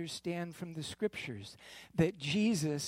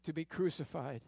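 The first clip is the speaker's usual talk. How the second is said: usual speaking voice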